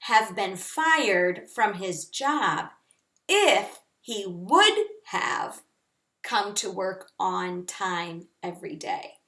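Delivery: slow, careful example sentence. Shown as a demonstration. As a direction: read it slowly for the class. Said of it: "You could say something like".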